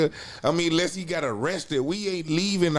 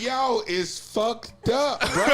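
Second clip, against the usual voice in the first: slow disbelief; 6 semitones higher, much slower than usual. Retold or imitated. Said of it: "I was like".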